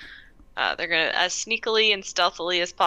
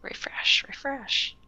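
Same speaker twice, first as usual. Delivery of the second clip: whispering; 7 semitones higher, 3 dB quieter, slower than usual. Real, not acted. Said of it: "whispering at the dice roller".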